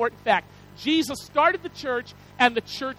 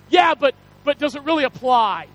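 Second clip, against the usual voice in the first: louder mock business-expert challenge; imitated challenge; 3 semitones higher, 7 dB louder than usual. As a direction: push it out like a speaker challenging the room. Quoting business expert challenging the audience.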